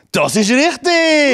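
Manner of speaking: high, loud and drawn out; excited announcer. Acted. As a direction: proclaim it like a game show host. Host announces a correct answer.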